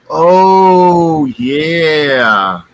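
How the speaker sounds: long, drawn-out words, high and loud then falling; imitated knowing agreement. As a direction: act out a friend who knows exactly what is meant. Friend knowingly agrees, retold.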